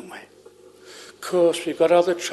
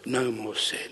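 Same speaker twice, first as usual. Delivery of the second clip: low, soft repeat, dying away; hushed echo; 6 semitones lower, 9 dB quieter than usual. Retold or imitated. Preacher echoing great aunt's longing, hushed and low. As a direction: let it sink into a reverent hush.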